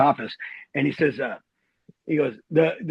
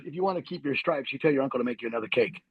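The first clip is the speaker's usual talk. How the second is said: low, soft voice of authority; quiet mock order; 4 semitones lower, 7 dB quieter than usual. Colonel hinting at sergeant about another cake, quietly imitated. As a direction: drop it lower and softer for the order.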